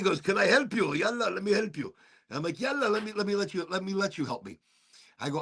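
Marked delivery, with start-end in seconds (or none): 0.2-1.9 put-on higher-pitched Middle Eastern accent. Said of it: "do a Middle Eastern accent". 2.6-4.6 put-on softer, higher Middle Eastern accent. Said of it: "do a Middle Eastern accent".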